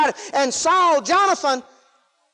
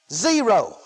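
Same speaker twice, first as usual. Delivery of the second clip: ordinary talk, nothing marked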